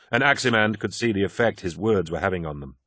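plain reading voice

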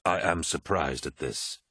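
usual speaking voice

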